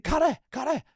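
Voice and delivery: very high retold call; imitating a high-pitched call to a teammate. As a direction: try to catch his attention.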